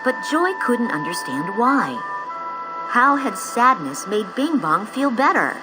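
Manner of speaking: usual speaking voice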